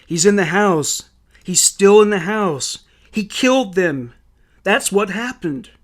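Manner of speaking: frantic insistence. A narrator's reading. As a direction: insist on it in alarm. Louis frantic at the scene.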